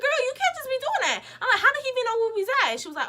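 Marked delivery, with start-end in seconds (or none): 0.0-1.2 high, loud retold telling-off; indignant scolding. Telling off the friend who started beef, indignant scolding. 1.6-2.7 high, fast, loud retold question; incredulous demand. Confronting friend about how he found them, incredulous demand.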